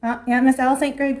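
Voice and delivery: usual speaking voice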